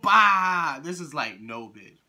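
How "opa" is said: very loud, high holler; shouted cheer. Acted. Unimpressed taster mocks the Greek snack, shouted cheer. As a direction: belt out a Greek party cheer.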